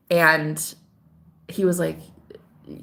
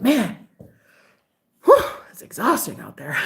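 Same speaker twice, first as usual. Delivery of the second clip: worn-out sigh; 3 semitones higher than usual. Retold or imitated. Quotes man back from shoveling.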